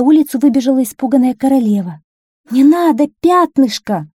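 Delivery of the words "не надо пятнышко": high, loud cry of a frightened mother; urgent, raised motherly cry; alarmed shout. A narrator's reading. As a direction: shout to stop the child. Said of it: "крикнула она".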